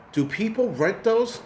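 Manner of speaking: high, slow mock voice of a puzzled passerby.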